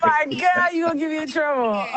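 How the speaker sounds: high-pitched mock dismay; high playful complaint, sliding down at the end; playful protest. Real, not acted. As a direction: exclaim it way up high, teasing. Repeats protest as host laughs.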